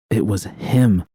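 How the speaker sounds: plain reading voice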